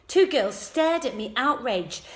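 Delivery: plain reading voice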